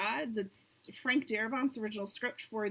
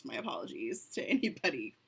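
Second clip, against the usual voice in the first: usual speaking voice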